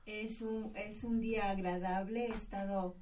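faint, distant voice over a weak line; bad connection. Real, not acted. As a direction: sounds far away on a poor call. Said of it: "No te escucho".

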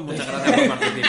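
high, loud, laughing show-host send-off; mock host sign-off. Ending the podcast early to dodge the question, hammed-up host farewell. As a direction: wrap it up like a cheesy host, laughing. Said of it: "son treinta y un minutos".

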